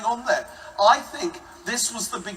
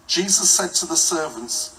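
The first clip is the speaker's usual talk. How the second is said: ordinary talk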